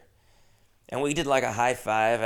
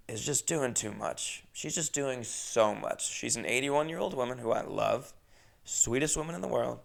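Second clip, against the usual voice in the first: ordinary delivery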